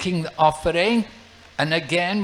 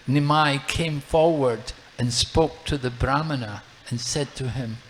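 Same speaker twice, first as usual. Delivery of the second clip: ordinary talk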